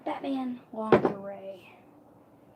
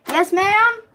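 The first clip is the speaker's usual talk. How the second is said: high, raised questioning call; calling back; 11 semitones higher, 8 dB louder than usual. Real, not acted. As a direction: call out to someone off in another room. Answers someone calling from elsewhere.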